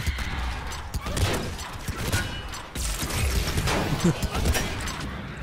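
high, airy laughing fit; breathless, drawn-out laughter; long laugh. Real, not acted. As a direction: crack up mostly without voice. Finds the moment funny.

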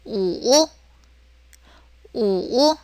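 우우 dipping low, then rising; soft, dipping 우우; third tone. Shown as a demonstration. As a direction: say 우우 low and drawn out in Mandarin third tone. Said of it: "우 해서 삼성".

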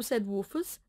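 ordinary delivery

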